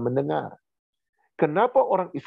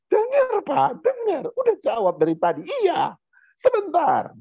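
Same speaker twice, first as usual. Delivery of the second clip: put-on child shouting back. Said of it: "apa yang dia bilang".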